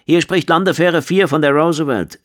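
high, projected radio hail; radio caller. A narrator's reading. Shuttle four hailing another shuttle, urgent radio call. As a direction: call in over military radio. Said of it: "tönte eine Stimme aus dem Funkgerät".

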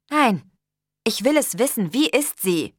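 heated, pressing demand; insistent demand. A narrator's reading. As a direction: refuse and demand to know. She insists on an answer.

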